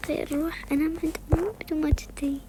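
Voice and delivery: quick secret whisper; fast whisper. Real, not acted. Girl plotting to sneak into mum's bed, whispered. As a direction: rush the plan under your breath.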